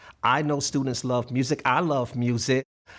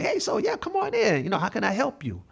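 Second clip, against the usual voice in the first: much higher, bright eager greeting, trying to break the ice; overly cheerful retry; 10 semitones higher, faster than usual. Retold or imitated. Teacher retelling greeting a silent dad, high and overly cheerful. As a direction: pitch it way up like an anxious host trying to win someone over.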